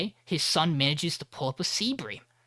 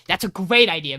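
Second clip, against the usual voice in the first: enthusiastic agreement; 4 semitones higher, 10 dB louder than usual. Retold or imitated. Everybody in room agrees.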